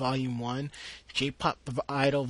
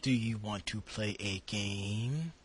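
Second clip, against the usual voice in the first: War Games quote; 5 semitones lower, 6 dB louder than usual. Retold or imitated. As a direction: deliver it like the movie's eerie computer. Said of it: "That was from, um, the movie War Games".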